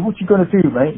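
lightly put-on British accent; imitating British boxer. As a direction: taunt like a cocky London boxer. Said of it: "He goes".

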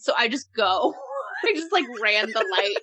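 usual speaking voice

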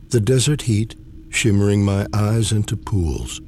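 ordinary talk, nothing marked

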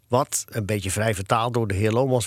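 plain reading voice

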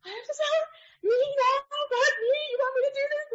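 high, teary squeak of panic; a terrified newbie on the verge of tears; panicked young resident. Retold or imitated. Terrified young resident told to deliver news.

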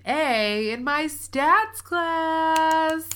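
high, slow and drawn out with pride; excited. Real, not acted. Proudly announcing her good grade.

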